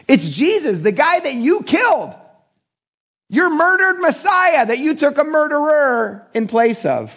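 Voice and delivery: high, drawn-out accusation; accusing proclamation. Retold or imitated. Preacher voicing apostles confronting religious leaders, accusing proclamation.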